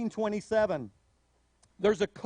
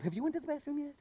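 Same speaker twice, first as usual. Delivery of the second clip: much softer, matter-of-fact mom; mother's stock reply; 5 semitones higher, 8 dB quieter, much faster than usual. Retold or imitated. Mother answering her child's complaint, soft and matter-of-fact. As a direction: ask it like a weary mother.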